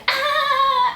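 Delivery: high shrieked mock outrage; a high shocked cry; cousins yelling. Little cousins react to her mispronunciation, shrieking high.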